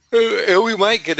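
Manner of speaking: stretched, high voice as a yawn takes over. Real, not acted. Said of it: "I'm sorry, I'm yawning".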